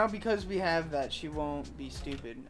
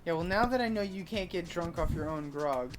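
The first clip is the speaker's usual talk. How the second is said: ordinary talk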